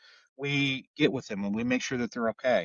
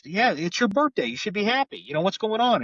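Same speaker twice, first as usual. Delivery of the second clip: higher-pitched cop imitation, coaxing; retold coaxing; 8 semitones higher, 3 dB louder than usual. Cop to a downcast birthday girl, retold coaxing. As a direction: retell an insistent pep talk, pitch lifted.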